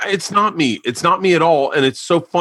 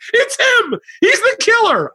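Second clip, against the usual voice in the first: excited daughter; slower than usual. Retold or imitated. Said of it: "And Isla goes".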